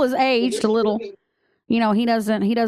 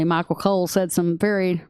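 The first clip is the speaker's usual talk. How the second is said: ordinary talk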